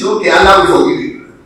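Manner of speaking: loud, insistent promise; forceful preaching, voice lifted. Acted. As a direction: call the assurance out forcefully. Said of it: "I raised my voice".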